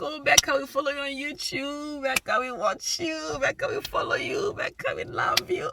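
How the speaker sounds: imitating fans. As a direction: mimic the adoring fans. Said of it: "they're like".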